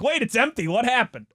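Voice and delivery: high, startled realization; suddenly notice the bag is empty; surprised. Retold or imitated. Imagined snacker finds bag empty.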